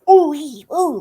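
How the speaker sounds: high, loud teasing ooh-ee-ooh; a hammed-up flirty coo; mock leering ooh. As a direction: imitate the drunk husband cooing at her hair. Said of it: "like that to my hair".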